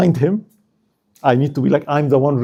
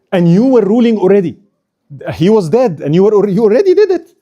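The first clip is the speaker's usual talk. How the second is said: louder, higher voice pressing the point; urging; 5 semitones higher, 5 dB louder than usual. Retold or imitated. Mamluks persuading her she already ruled, urging. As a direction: insist emphatically, rising as you go.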